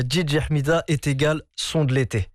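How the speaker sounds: ordinary talk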